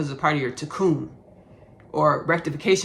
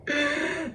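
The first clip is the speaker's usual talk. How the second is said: high giggle, quieter than the talk; laughing; 11 semitones higher, 5 dB quieter than usual. Real, not acted. Tickled by their own hometown story, laughing. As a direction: crack up in a high-pitched giggle.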